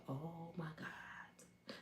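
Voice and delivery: slow, breathless whisper; stunned whisper. Retold or imitated. The girl seeing [name] behind her cousin, a stunned whisper. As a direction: breathe it out in shock.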